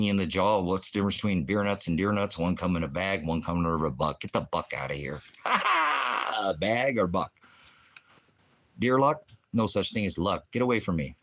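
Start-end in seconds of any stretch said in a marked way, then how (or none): 5.2-6.4 high laugh. Real, not acted. Laughs at own joke.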